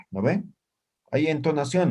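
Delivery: ordinary talk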